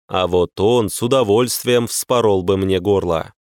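ordinary delivery

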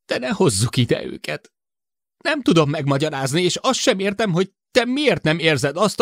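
high, urgent begging, pitched well up; anxious man pleading with his crewmate; pleading Sid. A narrator's reading. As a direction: beg her to listen just this once.

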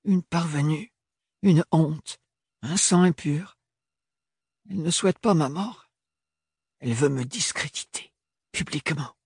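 jeune femme, voix plus aiguë, inquiète; Ophélie qui plaide sa cause, un peu plus haut; voix d'Ophélie. A narrator's reading. Ophélie voiced by narrator. (Ophélie convainc Thorne, voix plus aiguë et tendue)

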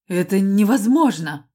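loud, rattled outburst; losing his composure. A narrator's reading. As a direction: shout it as control slips. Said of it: "прошипел он, теряя самообладание".

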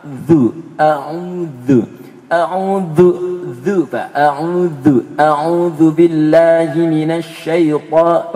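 loud, very slow A'udzu with a stretched dzu; a strict teacher hammering out A'udzu; loud drilled Arabic recitation; drilled pronunciation. Retold or imitated. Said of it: "Udah dibetulkan sampai setengah jam".

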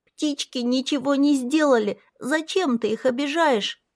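put-on high, upset child voice, close to tears; tearful child. A narrator's reading. Little girl near tears begs him not to hurt birds. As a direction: plead for the birds. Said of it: "появились слёзы".

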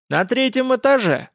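put-on high-pitched old crone, full of zest; excited old lady's voice; eager mumbling old woman. A narrator's reading. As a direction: mumble it keenly like a toothless granny. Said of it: "с азартом прошамкала старуха".

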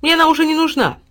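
high, loud and breezy; light, unconcerned exclamation; carefree. A narrator's reading. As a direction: wave it away cheerfully. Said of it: "беспечно махнул рукой Тиль-Тиль".

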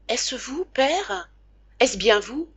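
put-on high, wondering child's voice, barely awake; a sick boy who can't believe his father is there; boy's waking voice. Son waking up and seeing his father at his bedside, high and amazed.

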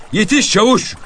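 high, loud taunting call; shouted call. A narrator's reading. Fugitive calling back to the pursuing sergeant, shouted. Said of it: "diye bağırdı".

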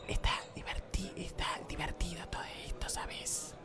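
hushed sultry whisper on a first date; seductive whisper. Acted. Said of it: "se les hable en voz baja".

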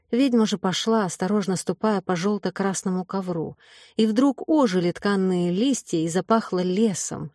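ordinary delivery, nothing marked

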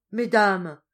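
loud high wailing call; mock wail. A narrator's reading. Duenna pretending to cry to the ladies, loud. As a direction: fake a tearful wail. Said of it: "en essayant de pleurer".

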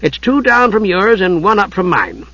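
high, cheery kender voice; cheerful. A narrator's reading. Tas directing Usha to Palin's room, cheerful. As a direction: give directions brightly, pitched high. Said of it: "said Tas cheerfully".

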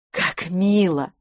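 slow, savoured exclamation; a drawn-out, mildly delighted cry. A narrator's reading. Miss Pettigrew takes the card, slowly exclaiming. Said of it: "воскликнула мисс Петтигрю".

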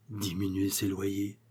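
dazed porter repeating the phrase quietly; muttered thought. Acted. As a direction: repeat it to yourself in disbelief. Porter keeps brooding.

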